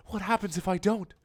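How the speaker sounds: high anxious question; timid castle voice; nervous castle. Acted. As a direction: ask it up high and worried.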